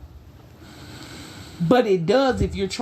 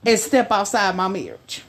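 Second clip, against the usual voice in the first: ordinary talk, nothing marked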